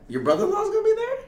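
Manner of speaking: fast, high, laughing disbelief; can't believe it; shocked question. Real, not acted. Host hearing the brother-in-law is coming, high and laughing.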